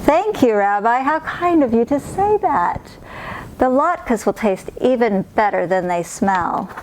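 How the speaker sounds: put-on warm thanks from a cheerful old lady; kindly old woman. Narrator voices Baba Brena.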